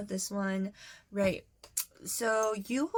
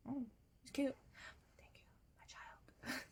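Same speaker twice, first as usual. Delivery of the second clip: hushed mock tenderness; 16 dB quieter than usual. Acts out exchange, voice drops away.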